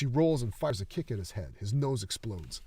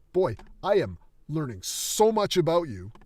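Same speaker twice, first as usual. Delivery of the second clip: higher, louder mocking remark; loud sarcastic quip; 6 semitones higher, 9 dB louder than usual. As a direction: needle them with sarcasm.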